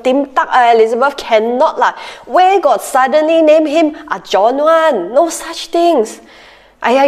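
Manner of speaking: Singlish, pushy and fussing. Retold or imitated. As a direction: nag in a Singlish accent. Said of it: "relatives in Singlish".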